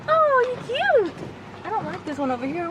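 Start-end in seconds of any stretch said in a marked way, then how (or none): 0.1-1.1 high, slow coo. Real, not acted. Talking sweetly to the cute animal, slow high coo.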